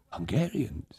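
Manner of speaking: soft, wondering repeat under the breath; puzzled quiet echo. A narrator's reading. Old Tag echoes the hussar's word, quiet and puzzled. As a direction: mutter it back to yourself, unsure. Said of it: "echoing the hussar".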